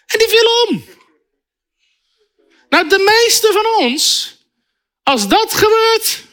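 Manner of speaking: high, fired-up preacher voice; fervent preaching. Acted. Preacher to congregation, fired-up fervent preaching. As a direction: preach it with rising fervor. Preacher retelling a miracle story.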